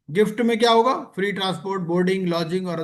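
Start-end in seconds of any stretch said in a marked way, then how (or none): none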